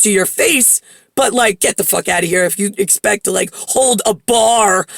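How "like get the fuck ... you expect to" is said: ordinary talk, nothing marked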